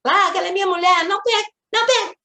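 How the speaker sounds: put-on high, fast, shrill little boy's protest; whiny child shouting. Storyteller mimics the boy shoving his father, high fast whine. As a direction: squeal it like a jealous small boy pushing dad away.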